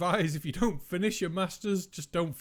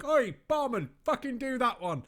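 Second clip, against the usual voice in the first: viewer voice; 8 semitones higher than usual. Retold or imitated. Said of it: "leave the comment down below saying".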